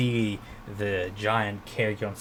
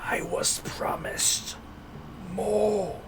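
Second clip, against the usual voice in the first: high, slow breathy monster whisper; whispering undead creature; 10 semitones higher, much slower than usual. A narrator's reading. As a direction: rasp it out like a dying ghoul. Same creature's dying words.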